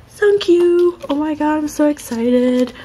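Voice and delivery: high, loud thrilled shriek; loud excited squeal. Real, not acted. Fan finishing her bias's card set, loud high squeal. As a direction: shriek the name in pure joy.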